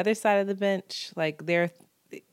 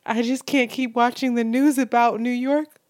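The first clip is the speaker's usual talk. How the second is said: mother's tearful voice, a bit higher and louder; 6 semitones higher, 4 dB louder than usual. Retold or imitated. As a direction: mildly voice a crying mother. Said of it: "She goes".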